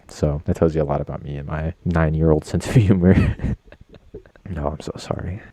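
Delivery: ordinary talk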